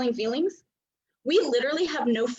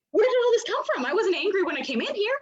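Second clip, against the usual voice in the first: confused; 8 semitones higher, 3 dB louder than usual. Retold or imitated. Confused open center.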